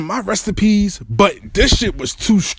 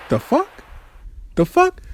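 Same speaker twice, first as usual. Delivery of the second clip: stunned disbelief; 11 semitones higher, 4 dB quieter than usual. Retold or imitated. Stunned that she misses him.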